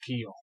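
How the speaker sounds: Keon said "kee-yon", French style with nasal ending; French pronunciation. Shown as a demonstration. Sword expert explains the French word, Keon with a nasal N. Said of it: "it was a French word".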